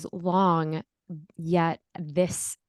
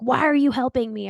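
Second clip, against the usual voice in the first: mock yell, higher and louder; retold yell; 6 semitones higher, 7 dB louder than usual. New mother yelling at her Indian mother-in-law, loud mock yell. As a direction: snap it back, half-yelling. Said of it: "I was, like, yelling at my mother-in-law".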